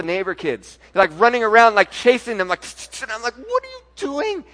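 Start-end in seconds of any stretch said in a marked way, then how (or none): 2.6-3.0 unvoiced spray hiss "tss, tss"; spray sound. Son spraying weed killer at neighbor kids, spray noise. 3.3-4.4 much higher, alarmed shout "What are you doing?"; startled mad yell. Retold or imitated. Dad catching his son spraying the neighbor kids, alarmed. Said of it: "And I was pretty mad".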